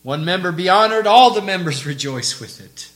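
loud, swelling scripture reading; forceful proclamation. Real, not acted. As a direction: proclaim it to the whole church. Preacher proclaims verse at full force.

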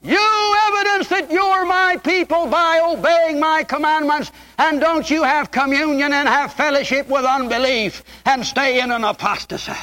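high, ringing preacher's cry; full-force preaching. Acted. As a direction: preach it out to the congregation.

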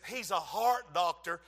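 normal talking voice

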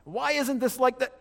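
high, loud exasperated outburst; frustrated. Retold or imitated. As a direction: act out a frustrated complaint. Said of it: "when I'm frustrated".

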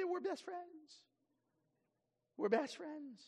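very high mock-child voice; child making up. Children suddenly friends after a quarrel, hammed-up high childish glee. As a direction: squeak it like a delighted little child. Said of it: "They go from".

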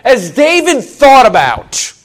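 high, loud fervent preaching; preaching at full force. Acted. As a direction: build the praise with fire. Preaching about rejoicing.

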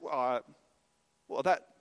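acted reluctant hedging, in broken bits; hesitant trailing off. Congregation faced with helping other people, mock hesitant. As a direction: stall as if the amen has died away. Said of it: "the amen kinda trails off a little bit".

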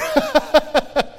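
a quick real laugh; brief chuckle at the joke; short laugh. Real, not acted. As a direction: laugh it off lightly. Laugh at chemo chair.

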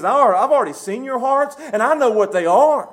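loud, high stern rebuke; Jesus confronting. Acted. Jesus confronting the Pharisees, stern loud rebuke. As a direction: declare it like a judge who has seen through them. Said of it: "He says".

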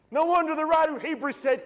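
ordinary talk, nothing marked

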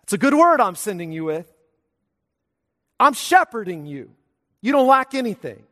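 preaching at full force. Real, not acted. Pastor proclaiming the good word to his congregation, fervent preaching. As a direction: proclaim it to the whole church, ringing out.

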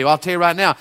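ordinary talk, nothing marked

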